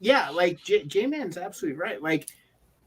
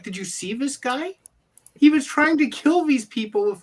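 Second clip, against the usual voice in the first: incredulous rant; 8 semitones higher, 4 dB louder than usual. Retold or imitated. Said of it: "I'm like".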